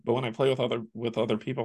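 normal talking voice

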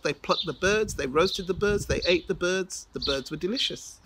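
usual speaking voice

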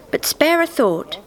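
usual speaking voice

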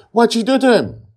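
high, loud accusing shout; frantic demand. A narrator's reading. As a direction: shout it at her in alarm.

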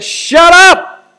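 high, loud furious "Shut up!" full of contempt; contemptuous rebuke. Acted. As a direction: bark it at a dirty demon. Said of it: "he has contempt".

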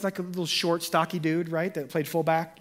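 ordinary talk, nothing marked

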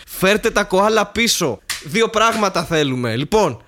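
slow, forceful demand like a campaigner; mock political demand. Mock political demand to return koalas.

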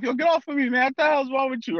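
high, scoffing dismissal from a buddy; mocking friend. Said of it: "And they'll push me off".

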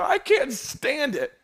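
high-pitched, drawn-out complaint; mock exasperation. Preacher imitating a worker who hates the job, mock exasperation. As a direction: whine it slowly as a fed-up worker. Voices a listener fed up with job.

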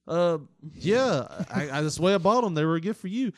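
hammed-up caught husband; flustered excuse. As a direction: stammer a lame lie. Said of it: "he's like".

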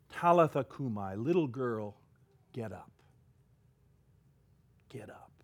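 put-on soft, fading call to wake a child; tender waking. Jesus gently tells the dead little girl to get up. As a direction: gently coax her awake, fading to a hush.

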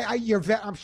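usual speaking voice

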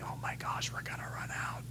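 panicked newlyweds whispering, barely audible; embarrassed whisper. Acted. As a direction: whisper it like an embarrassed couple keeping it secret. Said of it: "nearly embarrassed newly wed couple going".

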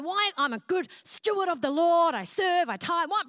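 retelling her angry complaint to God; big rant. Retold or imitated. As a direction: rant at God about being faithful. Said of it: "having a big rant".

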